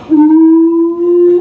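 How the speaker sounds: high, loud drawn-out schoolyard ooh; teasing ooh. Retold or imitated. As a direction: egg on the fight with a long ooh. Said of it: "never spoke but to say".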